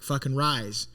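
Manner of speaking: ordinary delivery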